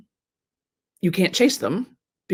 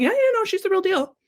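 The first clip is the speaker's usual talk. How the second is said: high, excited gushing praise; gushing fans; 11 semitones higher than usual. Retold or imitated. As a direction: rave like a true believer. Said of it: "Other people around the campfire going".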